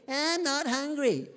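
a hint of a sulky kid refusing dinner; refusing child. Voices child refusing the meal.

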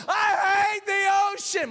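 high, loud furious yell; angry yell. Retold or imitated. Daughter Hope yelling at the sky after being pulled out, furious. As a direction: rage at the sky like a fed-up kid. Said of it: "She's just yelling at the sky".

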